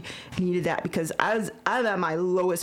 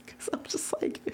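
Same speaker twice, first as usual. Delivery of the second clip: nervous whisper; 7 dB quieter than usual. Real, not acted. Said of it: "I'm just so nervous".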